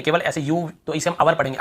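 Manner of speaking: ordinary talk, nothing marked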